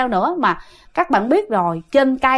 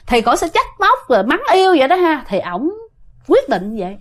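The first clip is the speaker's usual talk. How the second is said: ordinary delivery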